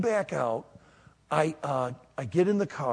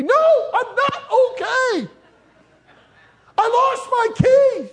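slow, high, loud anguished yell; all upset; 11 dB louder, slower than usual. Retold or imitated. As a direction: wail it loudly down the phone at your wife. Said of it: "and I'm all upset".

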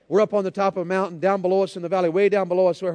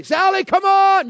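high, long, carrying yell; imitated grandfather's full-force holler; hollering for a dog; 13 semitones higher, 11 dB louder, slower than usual. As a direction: call a stray hound back from far down the valley. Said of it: "You can hear his voice echoing".

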